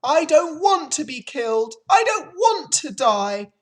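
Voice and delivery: high, loud, panicking piglet; frantic screaming. A narrator's reading. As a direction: plead hysterically at full voice. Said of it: "screamed Wilbur, racing up and down".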